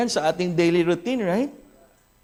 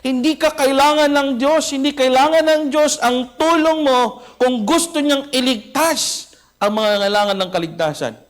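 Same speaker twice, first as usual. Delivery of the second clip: higher, louder scornful rebuke; scolding old pastor; 7 semitones higher, 4 dB louder than usual. Retold or imitated. Old pastor scolds young Carey in front of everyone, loud and high. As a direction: put the young upstart in his place. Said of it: "Sinabi ng matandang pastor sa kanya".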